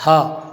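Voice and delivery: "ह" as a short breath of h plus a; visarga as "ha". Shown as a demonstration. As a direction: sound the visarga "ह" as ha. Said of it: "विसर्ग का उच्चारण जो होता है वो ह से होता है".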